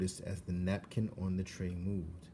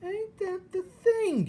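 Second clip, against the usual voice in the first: very high, loud slow crying shout; 7 dB louder, slower than usual. Acted. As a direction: holler it in rough servant-girl wonder. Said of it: "shouted Mig".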